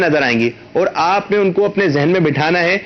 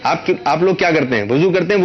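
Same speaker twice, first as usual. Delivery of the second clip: usual speaking voice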